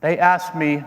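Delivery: usual speaking voice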